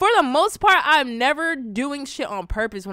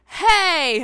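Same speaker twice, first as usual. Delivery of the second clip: high, loud scandalized call; mock shock; 11 semitones higher, 11 dB louder than usual. Vlogger reacting to a cursing kid, mock shocked.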